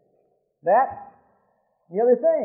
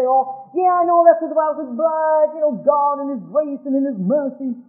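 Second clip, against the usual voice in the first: mocking excuse; 6 semitones higher than usual. Mocks an excuse-maker.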